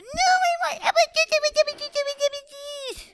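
high whining chant, fading away; whiny baby tantrum. Acted. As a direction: repeat it like a sulking toddler. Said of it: "Everything the baby said was a whine".

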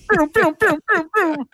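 very high, bouncy booms; high playful booms. Retold or imitated. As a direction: chant it high like a fast cat. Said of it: "she's just like".

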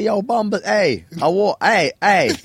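high, loud demanding calls; mock rude customer. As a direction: act out barging up to a food counter. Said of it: "I'm just rude as well".

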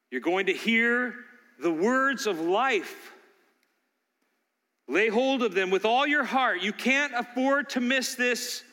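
slow, emphatic preaching; preacher pleading with the congregation, slow and emphatic. Acted. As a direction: drive each word home. Preaching at full force.

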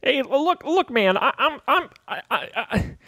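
stammering, pleading underling; nervous intern, tripping over repeated words; flustered intern guard. Acted. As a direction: babble excuses like a cornered intern.

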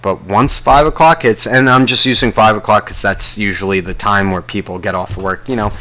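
ordinary talk

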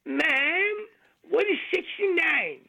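the cowboy pitched high, innocent question; young cowboy voice. Acted. Young cowboy asking the brothel madam, voice pitched high.

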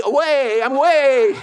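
put-on high, loud little kid insisting, breaking into a laugh; a stubborn toddler's cry, laughing. Toddler voiced running off.

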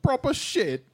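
very high fake-British swearing; hammed-up, high British; mock British accent. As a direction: squeak it in British parody. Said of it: "British accent I've ever heard".

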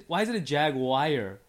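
JAGUAR as "JAG-wire"; mocked mispronunciation. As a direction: mimic jaguar ending in "wire". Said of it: "I'm making fun of you".

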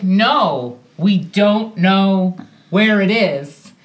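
slow, deliberate, spaced-out words; retold insistence, drawn-out and emphatic; firm refusal. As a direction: spell it out firmly for a pest. Retold firmer answer when asked again.

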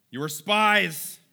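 level the charge slowly and forcefully; accusing. Acted. Acted accusation from Joseph.

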